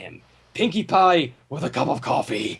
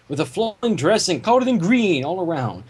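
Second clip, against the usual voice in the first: usual speaking voice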